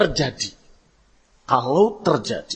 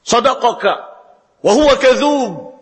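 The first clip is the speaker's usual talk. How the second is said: higher and louder, ringing proclamation; a preacher proclaims a hadith line; emphatic hadith quote; 7 semitones higher, 5 dB louder than usual. Acted. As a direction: declaim the quote.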